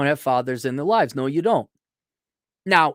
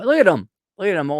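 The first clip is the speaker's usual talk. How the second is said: loud look at him; 4 semitones higher, 6 dB louder, faster than usual. Real, not acted. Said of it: "there's a picture of you".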